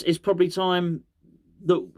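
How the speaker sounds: normal talking voice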